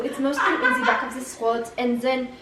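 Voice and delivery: explaining in French-accented English. Shown as a demonstration. Child explains how the accent works, French accent. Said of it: "the French accent is pretty easy to do".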